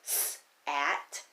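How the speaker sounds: S-at split into s and at; sounding out. Shown as a demonstration. Teacher sounding out sat for pupils. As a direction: break S-at into its sounds. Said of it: "Can you tap out sat?".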